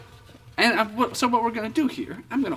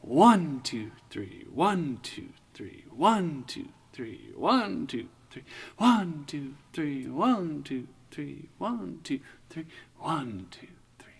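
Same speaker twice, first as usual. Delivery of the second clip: counting the beat; 3 semitones lower, 6 dB quieter than usual. Shown as a demonstration. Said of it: "swing it with the beat".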